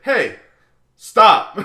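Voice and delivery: loud, high shushing order; sharp call-out. Retold or imitated. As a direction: call out to noisy moviegoers. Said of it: "I'm just like".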